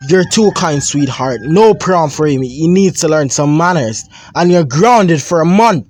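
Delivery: loud, high, firm refusal; father refusing to reconsider; stern father. Acted. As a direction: brush off the plea, then punish.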